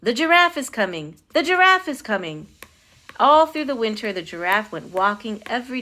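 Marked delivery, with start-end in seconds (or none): 0.0-2.5 raised announcing shout, building higher; shouting policeman. A narrator's reading. Policeman warning the crowd, shouting. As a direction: cry out a repeated warning to the street. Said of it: "policeman shouted".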